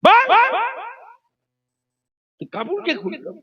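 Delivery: a mother snapping at a son gone mad; mother scolding. Acted. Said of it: "মা তো কি ধমক দিচ্ছে?".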